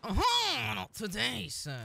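a hint of a French accent, teasing the enemy; mock French accent. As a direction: toss it off in a jokey French accent. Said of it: "I turned fucking French for a second".

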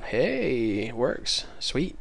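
ordinary delivery

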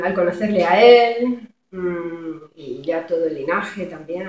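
usual speaking voice

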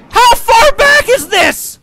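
loud high, slow cry; disbelieving shout. Real, not acted. Gamer thrown back again, yelling in disbelief. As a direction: stretch it out in disbelief.

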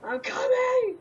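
high, loud call; shouting. Real, not acted. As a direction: call out while rushing. Rushing to teammate.

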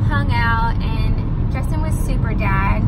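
vlogger intro. Acted. Vlog intro to camera.

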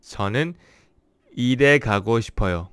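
일에 가고 싶어요 said fast in one breath; fast retake. Shown as a demonstration. Teacher retrying a Korean sentence after a slip, fast. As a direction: run 일에 가고 싶어요 together quickly. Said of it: "when I speak slowly, it kind of messes up".

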